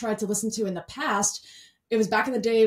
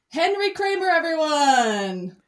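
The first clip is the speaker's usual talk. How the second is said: big drawn-out guest intro, pitched high; ringing, high, elongated introduction; announcer introduction; 6 semitones higher, 4 dB louder, slower than usual. Real, not acted. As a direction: announce the guest like a show host, loud and stretched. Host introducing the guest to listeners.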